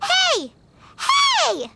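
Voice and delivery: indignant shout. Acted. Paimon yelling after Margaret as she walks away, a high indignant shout. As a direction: yell to stop her leaving, rising in outrage.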